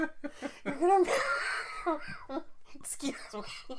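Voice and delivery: helpless laughter, slowed words; laughing fit. Real, not acted. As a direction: crack up mid-sentence. Breaks up laughing.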